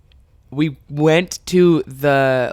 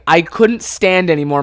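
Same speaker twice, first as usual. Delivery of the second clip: ordinary delivery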